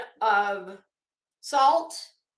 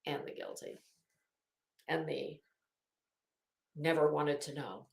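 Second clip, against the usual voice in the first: whispered aside; 8 semitones lower, 8 dB quieter than usual. Acted. Teasing Betty about the guilty partygoers in a whisper. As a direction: whisper it under the breath.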